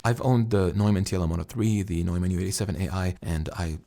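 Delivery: ordinary talk, nothing marked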